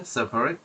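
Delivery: সেপারেট said loosely as "sepret", middle vowel swallowed; careless pronunciation. Shown as a demonstration. Teacher showing students how সেপারেট is pronounced, loose. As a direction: say সেপারেট the way it sounds in fast speech. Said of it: "উচ্চারণের সময় এইভাবে আসে".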